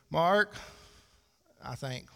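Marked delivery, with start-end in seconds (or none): 0.1-0.6 calling his name loudly from the other room; raised voice calling Mark; wife calling out. Retold or imitated. Wife calls husband from another room, loud call. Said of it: "hear Lauren say".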